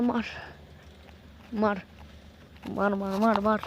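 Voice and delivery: usual speaking voice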